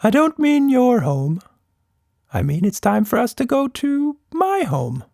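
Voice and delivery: a creature gently revealing a goodbye; monster voice. Acted. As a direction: monster explaining it must leave. Monster explains going home.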